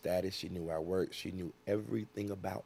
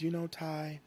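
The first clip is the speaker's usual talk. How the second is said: tipsy wife voice; 9 semitones higher than usual. Retold or imitated. She was drunk.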